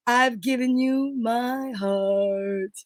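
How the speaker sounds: slow, soft whispered closing line; ending the love song with a whisper; whispered lyric. Acted. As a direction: breathe the last line in a whisper.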